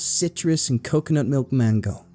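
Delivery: snippy big sister shooing her brother; huffy sister. A narrator's reading. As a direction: list it pointedly, then threaten. Offended sister retorting, threatening brother.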